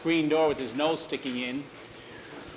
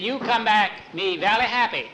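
higher-pitched pidgin in mock Chinese accent; 6 semitones higher, 4 dB louder than usual. Ranch cook asking Jackie back, in mock Chinese accent. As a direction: imitate a Chinese cook, "valley" for "very". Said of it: "valley instead of very".

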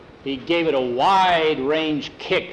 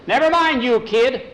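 put-on high, loud brush-off of a kid; storyteller acting an irritated foreman; angry cut-off; 9 semitones higher, 6 dB louder than usual. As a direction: cut the boy off sharply, pitched way up. Foreman cuts off the boy.

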